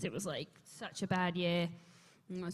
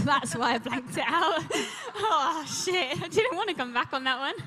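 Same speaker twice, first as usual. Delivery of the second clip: laughing; 6 semitones higher, 8 dB louder than usual. Real, not acted. Laughing remembering The Voice.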